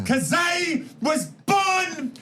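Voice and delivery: slow, high shouted Flair brag; Ric Flair impression. Doing Ric Flair's promo for the guys, shouted high. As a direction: strut it out like the Nature Boy. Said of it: "Ric Flair used to stand there and go".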